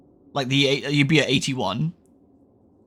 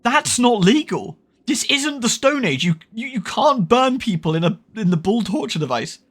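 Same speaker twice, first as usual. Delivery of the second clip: mock outrage; 7 semitones higher than usual. Real, not acted. Outraged at game text.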